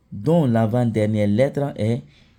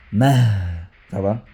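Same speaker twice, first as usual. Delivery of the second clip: ordinary talk, nothing marked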